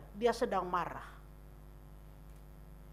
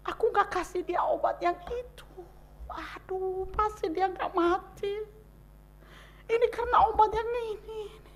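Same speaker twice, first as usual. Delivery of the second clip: anguished regret; 10 semitones higher, 4 dB louder than usual. Retold or imitated. Bereaved wife lamenting she gave wrong medicine, high and tearful. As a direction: wail with what-ifs. Voicing bargaining widow.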